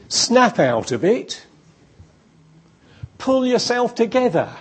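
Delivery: mock stern advice. Mocking impatient advice to the depressed, loud. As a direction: bark it like an impatient adviser. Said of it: "what do you not want to hear".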